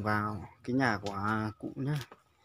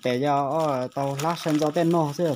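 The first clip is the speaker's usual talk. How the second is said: to someone hard of hearing; 6 dB louder than usual. Real, not acted. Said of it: "phải nói to to bà cụ mới nghe được".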